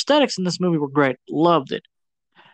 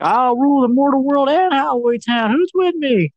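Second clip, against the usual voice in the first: villain mayor; 9 semitones higher than usual. Retold or imitated. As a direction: proclaim world domination, hammed-up. Mayor villain announces rule.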